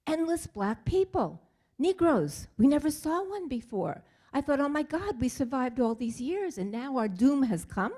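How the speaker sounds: voicing the father's panic at seeing the soldiers; retold fear. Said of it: "as he says in this interview".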